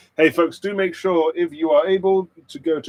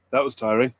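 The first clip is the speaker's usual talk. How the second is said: usual speaking voice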